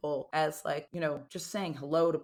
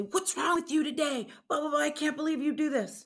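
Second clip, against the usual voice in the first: higher, nagging mock boss voice; high-pitched mock reprimand; scolding boss; 10 semitones higher, 3 dB louder than usual. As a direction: mimic a scolding coworker, exaggerated. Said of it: "a leader or a coworker say".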